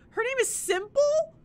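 high, incredulous question; surprised. Real, not acted. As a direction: squeak out the disbelief. Shocked at an item name.

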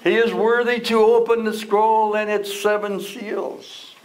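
high, ringing announcement of victory; triumphant proclamation. Acted. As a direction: proclaim it like good news to a weeping man. Elder's triumphant quote in sermon.

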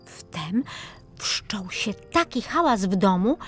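usual speaking voice

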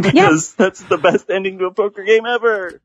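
high-pitched, delighted enthusiasm; excited gushing. Real, not acted. Raving about the poker ending to co-hosts, excited gushing. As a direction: pitch soars as you rave about it.

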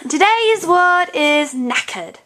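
slow British accent announcement. Shown as a demonstration. Teacher gives word of the day, British accent. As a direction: present it slowly in a British accent. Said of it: "she likes to talk in a British accent".

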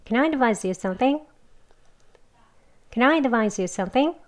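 조금 더 빠르게. Shown as a demonstration. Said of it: "빨리 더 두 번 해보겠습니다".

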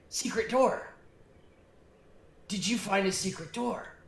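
high goblin voice, a sudden realisation. Acted. Goblin telling the party about the door, high. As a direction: blurt it out like a goblin remembering. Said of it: "and he said".

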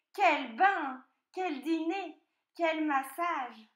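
loud, high relishing exclamations; delighted piglet. A narrator's reading. Piglet leaving with his cakes, loud delight. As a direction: savour each treat, stretching the last.

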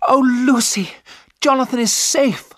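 high, loud cry of relief; overjoyed cry. A narrator's reading. Mina telling Lucy that Jonathan is safe, crying out joyfully. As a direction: burst out with the good news. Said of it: "Mina cried".

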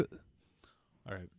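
breathy aside under the breath; whisper. Real, not acted. Whispering an aside to the friends. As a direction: drop to a voiceless hush. Aside while scolding Ross.

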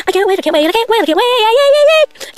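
can't-wait chant climbing up; rising excitement. Real, not acted. Kid excited about the fair.

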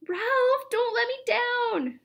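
very high, pleading call. A narrator's reading. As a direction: call out over a noisy crowd. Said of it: "Ryan's voice rose above the shouting".

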